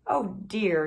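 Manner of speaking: plain reading voice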